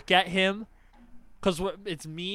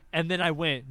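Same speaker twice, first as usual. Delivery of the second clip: ordinary talk, nothing marked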